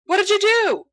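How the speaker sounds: high, loud alarmed yelp. Acted. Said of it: "Sirius yelps".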